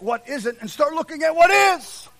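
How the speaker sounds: high, loud exhortation to the congregation; forceful preaching. Real, not acted. Preacher urging people to look at what is, forceful preaching.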